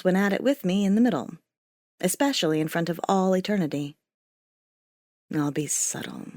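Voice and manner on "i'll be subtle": whispered. Acted. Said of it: "he whispered close to my ear".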